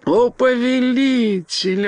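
very high, stretched-out exclamation of joy; a simple bearskin creature overjoyed by its master; joyful cry. A narrator's reading. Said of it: "радостно вскричала медвежья шкура". (радостный протяжный возглас высоким голосом)

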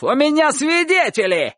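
high-pitched indignant cry; shouting. A narrator's reading. Dog-catcher claiming witnesses in the yard, shouting. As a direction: insist in a high yell. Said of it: "кричал Собаколов".

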